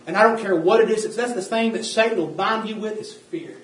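loud, driving sermon voice; preaching at full force. Acted. Preacher warning the saints about fear, preaching at full force. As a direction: preach it hard to the room.

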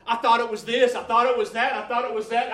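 loud, high exultant cry; overwhelmed praise, shouting to God in the truck; ecstatic shouting. Retold or imitated. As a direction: shout it out like the moment of revelation. Retold ecstatic outcry to God.

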